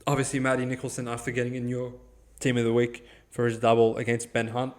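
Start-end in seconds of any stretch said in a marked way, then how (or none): none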